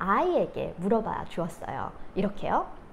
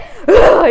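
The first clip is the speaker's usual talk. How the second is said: put-on high, very loud huffy grunt of a sulking child; mock huff; 9 semitones higher, 19 dB louder than usual. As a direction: huff it out like a cross little girl. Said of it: "이러면서 딱 앉는데".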